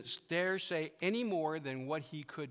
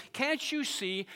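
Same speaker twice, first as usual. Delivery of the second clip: ordinary talk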